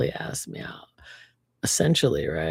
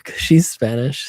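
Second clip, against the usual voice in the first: normal talking voice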